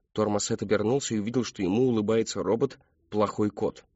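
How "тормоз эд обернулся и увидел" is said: ordinary delivery, nothing marked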